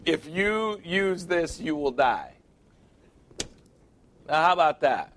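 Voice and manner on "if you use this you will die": warning label. Retold or imitated. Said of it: "they have on the package".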